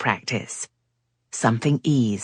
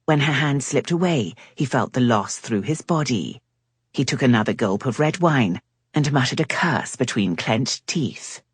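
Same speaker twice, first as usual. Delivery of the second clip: usual speaking voice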